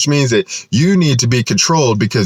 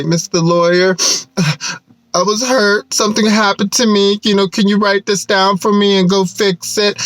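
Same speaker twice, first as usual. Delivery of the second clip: higher, pleading, whiny mock voice with a sigh; mock complainer to lawyer; 9 semitones higher than usual. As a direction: imitate someone begging a lawyer for help, higher and whiny. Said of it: "And they say".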